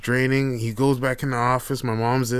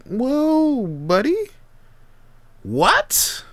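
much higher, loud disbelief; startled; 13 semitones higher, 3 dB louder, much slower than usual. Retold or imitated. Uncle reacting to nephew's phrase, startled high. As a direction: jump up in pitch, caught off guard.

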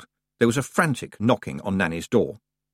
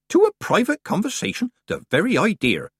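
mock offended Nanny; 12 semitones higher, 3 dB louder than usual.

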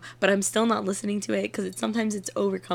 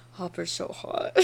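slow, soft whispered swoon; whispered mock swoon; 3 semitones lower, 10 dB quieter, slower than usual. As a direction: breathe it like a secret crush. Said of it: "And you were like".

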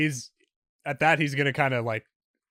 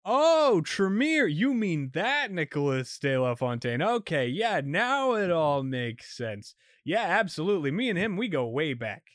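cop faking sudden recognition, jumping higher; lying cop; 6 semitones higher than usual. Acted. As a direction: bluff eagerly.

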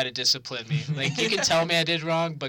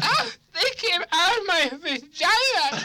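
high, loud, slow, giggling mom voice; drunk mom boast; 6 dB louder, slower than usual. Retold or imitated. Son mimicking drunk mom showing off to friends, high and shrill-laughing. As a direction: shriek it like a tipsy proud mother.

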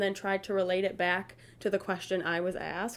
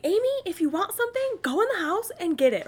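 higher, louder mock-bossy order to mom; teasing bossy tone; 10 semitones higher, 5 dB louder than usual. Daughter teasing her mom at the grill, mock-bossy. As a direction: playfully boss her around. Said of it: "messing with my mom".

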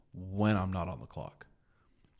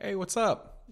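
high-pitched mock phone chatter; oblivious caller; 11 semitones higher, slower than usual. Laptop caller chatting in a coffee shop, high mocking voice. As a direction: imitate an annoying caller, pitched way up. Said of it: "sitting in there on their laptop and they're just like".

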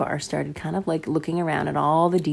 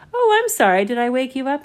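put-on innocent boy; 7 semitones higher, 7 dB louder than usual. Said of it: "And Bar just said".

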